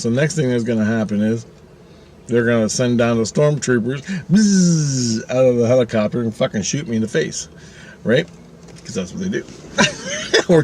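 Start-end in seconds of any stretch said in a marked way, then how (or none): 4.3-5.3 higher-pitched sustained buzz; mouth-made zip-line noise; imitated zip-line buzz. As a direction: buzz like a rope zipping down. Imitates stormtroopers zip lining down.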